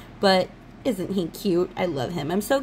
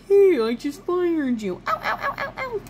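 goblin impression; 9 semitones higher than usual. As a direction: squeal like a goblin getting hurt. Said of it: "That's the guy who fired him".

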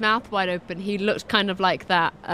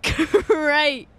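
laughing; 7 semitones higher, 3 dB louder than usual. Real, not acted. Laughs at own mistake.

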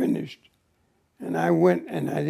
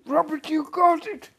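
high, loud squeal of joy; imitating an overjoyed maid, shouting high; excited maid; 14 semitones higher, 13 dB louder than usual. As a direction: cry out the good news, shrill with excitement. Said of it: "she was so excited".

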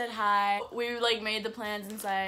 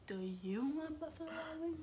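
soft, higher-pitched mimicking question; imitated echo back; 7 semitones higher, 10 dB quieter than usual. Repeating the food question back to Gio, soft and high.

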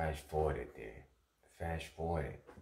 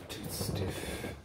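frustrated outburst; 5 semitones higher, 5 dB louder than usual. Real, not acted. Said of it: "too stiff tonight".

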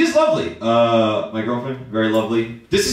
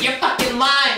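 put-on accusing girlfriend; 13 semitones higher, slower than usual. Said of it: "She goes".

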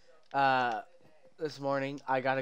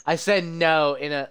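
ordinary delivery

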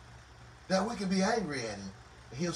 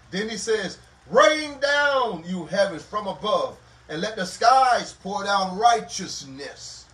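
louder, booming declaration, slowing on the last words; preaching at full force; 6 dB louder than usual. Acted. Preacher to congregation, thundering Isaiah's verses at full force. Loud scripture reading in sermon.

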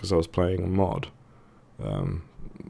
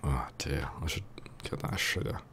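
whispering; 3 semitones lower, 7 dB quieter than usual. Real, not acted. Muttering to himself while playing.

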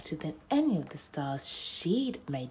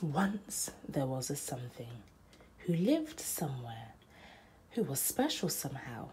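whisper; 3 semitones lower, 5 dB quieter than usual. A narrator's reading. Storybook whisper opening.

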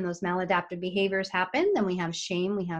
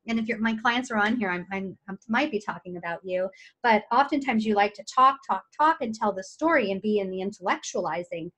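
ordinary talk

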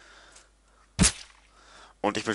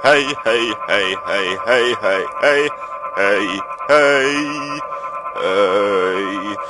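calling out; much slower than usual. Real, not acted. Gamer calling at other players.

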